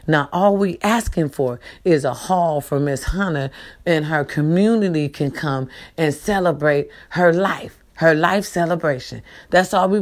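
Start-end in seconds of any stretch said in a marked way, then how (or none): none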